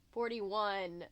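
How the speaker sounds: usual speaking voice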